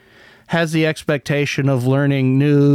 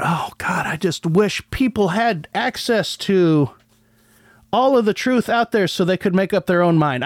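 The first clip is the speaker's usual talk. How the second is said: lightly voiced wishful coder, higher pitch; mock idealistic coder; 5 semitones higher than usual. Podcaster scoffing at coders writing code at home, mock wistful. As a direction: sigh it out as a mock-earnest wish. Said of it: "anyone's sitting at home, uh, writing code going".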